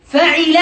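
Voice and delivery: فعل said fa-'i-la, middle vowel i; kasra on ain. Shown as a demonstration. Teacher listing verb patterns, فعل as fa'ila. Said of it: "بكسر العين".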